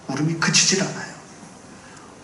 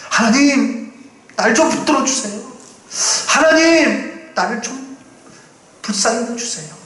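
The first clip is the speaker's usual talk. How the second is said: desperate prayer; 7 semitones higher than usual. Acted.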